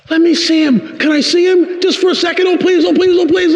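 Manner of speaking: put-on very high, childlike pleading; excited begging. Man sitting up in bed begging to see, excited. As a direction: beg eagerly in a squeaky rush. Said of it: "like this".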